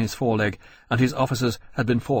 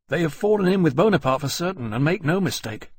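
fast, raised, heated officer's voice; smouldering; 7 semitones higher, faster than usual. A narrator's reading. Ferris to officers, smouldering anger at the Turks. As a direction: let the anger smoulder through quickly.

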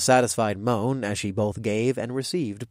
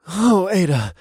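higher, louder moan of a name; 7 semitones higher, 5 dB louder, slower than usual. Acted. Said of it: "I moaned".

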